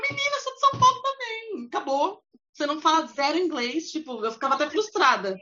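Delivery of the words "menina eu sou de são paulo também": high, chummy mock of a fellow Brazilian; chummy Brazilian waiter. Waiter greeting a Brazilian customer, chummy high voice. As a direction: pitch it up like a friendly waiter from home. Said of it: "olha pra sua cara e fala".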